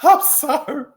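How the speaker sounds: high giggly apology; laughing apology. Real, not acted. Host apologizing to listeners, laughing. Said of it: "I don't know why I'm laughing".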